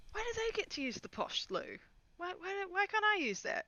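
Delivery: very high-pitched complaint; squeaky, petulant protest; indignant whining. Acted. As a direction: whine about unfair treatment, voice way up. Character whines about the posh loo.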